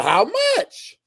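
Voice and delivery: high-pitched amazed question; can't believe the number; incredulous squeak of amazement; astonished. Retold or imitated. Hearing about the back pay.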